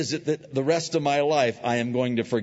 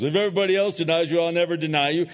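fast, higher, louder eager vow; boastful Peter; 7 semitones higher, 6 dB louder, much faster than usual. Retold or imitated. Peter boasting to Jesus, fast and eager. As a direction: rush out a bold promise. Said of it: "when Peter says".

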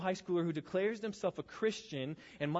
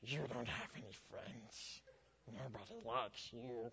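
lower, much softer, sneering creature voice; quiet, nasty Gollum imitation; 4 semitones lower, 13 dB quieter than usual. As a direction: taunt like the mean Gollum. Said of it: "He goes".

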